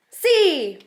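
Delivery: high eager yes; excited child. Acted. Little girl eagerly answering her papi, high and bright. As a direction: chirp it out, thrilled to ride.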